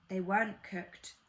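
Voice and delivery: ordinary delivery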